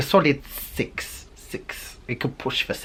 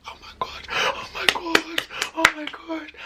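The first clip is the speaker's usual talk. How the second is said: panicked; 4 dB quieter than usual. Retold or imitated. Panicking in bathroom.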